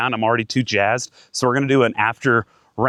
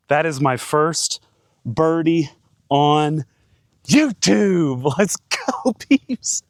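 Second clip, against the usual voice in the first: excited; 5 semitones higher, much slower than usual. Real, not acted. First birdie on YouTube.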